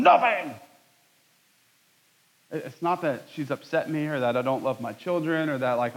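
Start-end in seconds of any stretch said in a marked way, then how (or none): 0.0-0.5 a higher, louder defensive snap; retold snap. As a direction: bite back a grumpy nothing. Snapping back at wife.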